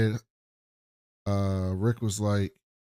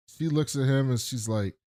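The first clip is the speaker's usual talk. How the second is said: ordinary delivery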